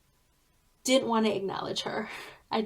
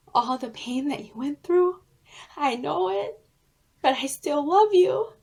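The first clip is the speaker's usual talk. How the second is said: rising higher and louder with feeling; tender; 5 semitones higher, 5 dB louder than usual. Retold or imitated. Little May forgiving her, swelling tender voice. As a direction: swell into heartfelt love. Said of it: "as if she was saying to me".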